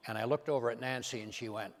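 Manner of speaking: ordinary delivery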